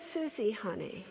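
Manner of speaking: soft, tender motherly voice; gentle mother. Retold or imitated. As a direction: let her down gently, softly. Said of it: "And she says".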